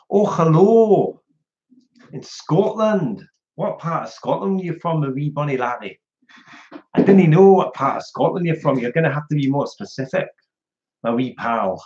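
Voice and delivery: playful put-on Scottish accent. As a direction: greet a fan in mock broad Scots. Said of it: "I like my Scottish accent".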